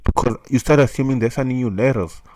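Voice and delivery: normal talking voice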